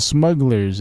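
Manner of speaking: ordinary talk, nothing marked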